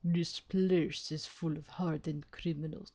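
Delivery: low, soft attempt sliding into Russian; accidental Russian accent. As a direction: try an Irish warden, slip into Russian. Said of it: "why it turned Russian".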